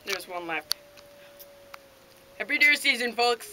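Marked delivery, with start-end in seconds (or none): 2.4-3.5 loud sign-off. Real, not acted. Sign-off to viewers.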